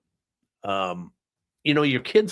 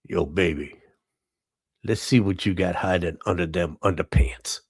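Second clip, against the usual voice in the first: lower, hammed-up pickup line; a mock come-on to a wife, said lower; mock seductive line; 4 semitones lower than usual. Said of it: "you can say that without laughing".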